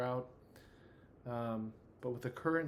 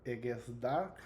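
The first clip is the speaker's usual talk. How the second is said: put-on French accent; slower than usual. Game master attempting the new character's French accent, tentatively. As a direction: try a wobbly French accent. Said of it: "I could do this French accent".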